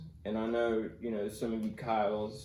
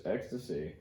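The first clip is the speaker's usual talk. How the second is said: a low, flat one-word answer; deadpan drug dealer; 7 semitones lower, 6 dB quieter than usual. Retold or imitated. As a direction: say it like it's nothing, no pause. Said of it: "It's just something like this".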